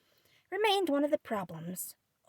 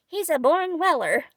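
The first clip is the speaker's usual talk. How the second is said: laughing; 5 semitones higher, 8 dB louder than usual. Acted. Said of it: "laughed Joe".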